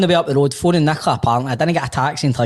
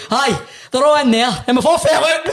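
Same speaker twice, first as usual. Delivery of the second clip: much higher, cracking up mid-quote; laughing retold reply; 10 semitones higher than usual. Telling a fan the hosts fell out, laughing. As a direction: giggle through the confession. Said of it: "he turns around the guy and goes".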